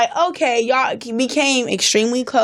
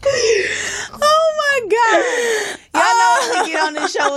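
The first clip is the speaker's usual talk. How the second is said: shrieking through laughter; cracking up and half yelling; laughing; 10 semitones higher than usual. Real, not acted. Laughing crying intro restart.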